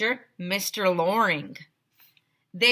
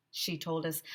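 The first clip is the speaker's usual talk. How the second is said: ordinary delivery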